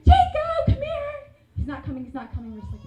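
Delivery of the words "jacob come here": much higher, louder call across the house. Retold or imitated. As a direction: yell for him with rising impatience. Calling brother again, louder.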